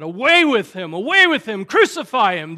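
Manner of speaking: loud shouts of an angry mob; crying out. Acted. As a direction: cry out like a crowd demanding death. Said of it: "They cried out".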